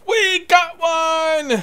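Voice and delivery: high, drawn-out shout of triumph; excited shout. Real, not acted. Gamer spotting his prey, shouting with thrilled excitement. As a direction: yell it out, thrilled.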